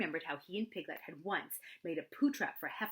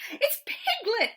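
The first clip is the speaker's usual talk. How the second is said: much higher and louder, slowed in delight; eager cry; 11 dB louder, much slower than usual. Acted. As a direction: cry out eagerly on recognizing a friend. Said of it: "cried Pooh eagerly".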